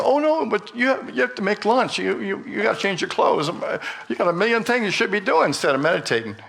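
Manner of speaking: high, fast, fretful inner nagging; mock monkey mind. The monkey mind nagging the meditator, high and fast. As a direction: rattle off the chores like a panicky inner voice. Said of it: "the monkey mind is gonna go".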